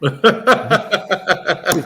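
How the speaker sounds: slow, laughing request. Retold or imitated. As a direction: plead slowly through laughter. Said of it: "and she said".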